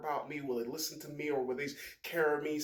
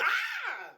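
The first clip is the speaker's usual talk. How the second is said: sudden high yelp, much louder; mock pained yelp; 5 dB louder than usual. Swearing on a hot Bible, mock pained yelp. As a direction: jolt off a burning-hot Bible.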